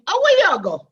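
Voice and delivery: brush-off. Acted. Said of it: "if you don't like him".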